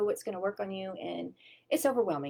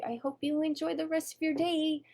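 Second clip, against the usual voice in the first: higher, sugary send-off; sweet farewell; 8 semitones higher than usual. Retold or imitated. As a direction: wish him well with a bright lift. Calling to driver rolling away.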